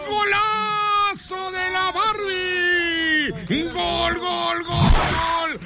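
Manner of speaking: long held goal scream, very high; breakneck goal commentary. Real, not acted. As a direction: stretch out the GOL like a stadium announcer. Radio host calls the goal.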